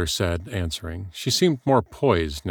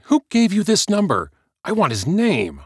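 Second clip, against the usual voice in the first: much higher, louder demand; angry demand; 13 semitones higher, 5 dB louder than usual. Acted. Heather demanding who gave out her number, angry. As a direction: insist on the name. Actress demanding caller's source.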